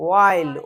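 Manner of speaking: ওয়াইল্ড said clearly with a w glide opening, like wa-ild; pronunciation demo. Shown as a demonstration. Teacher shows students how ওয়াইল্ড is pronounced, opening on a w glide. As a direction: model ওয়াইল্ড slowly, starting on the w glide sound wa. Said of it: "কিন্তু উচ্চারণটা".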